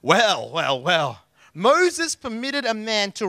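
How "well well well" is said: low, drawn-out knowing "well, well, well"; mock knowing tut. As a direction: let it hang like you caught someone out. Preacher opens with knowing drawl.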